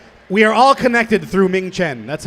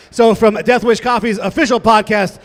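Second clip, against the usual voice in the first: loud, high emcee intro over music; hype announcer; 6 semitones higher, 8 dB louder than usual. Acted. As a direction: build it up like a stage announcer. Hypes guests onto the stage.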